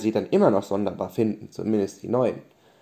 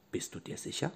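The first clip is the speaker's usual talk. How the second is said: whispered; 14 dB quieter than usual. Acted. Erzählerin fragt zweifelnd.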